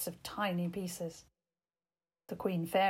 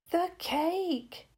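a bright, higher excited cry from the hare; 9 semitones higher than usual. A narrator's reading. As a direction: blurt it out thrilled. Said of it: "said excitedly".

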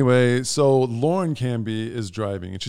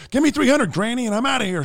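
high mock demand; demanding scammer; 12 semitones higher, faster than usual. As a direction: imitate a hustler asking for cash, pitched up. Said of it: "he was like".

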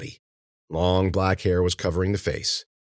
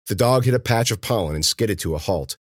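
ordinary delivery

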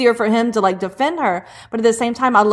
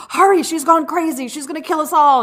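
high, hurried alarm; mock panic, pitched up; panicked warning; 6 semitones higher than usual. A Klansman runs to Graham in a lightly voiced panic.

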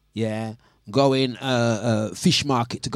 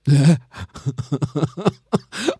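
loud laugh; 3 semitones higher, 6 dB louder than usual. Real, not acted. Laughing at friend's bill.

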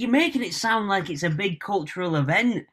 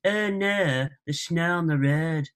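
Hull accent with "er" for every "oh"; slower than usual. Shown as a demonstration. Showing a southerner the whole accent, Hull accent. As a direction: demonstrate a Hull accent on the long o's. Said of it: "easy to do a whole accent".

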